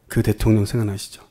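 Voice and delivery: ordinary delivery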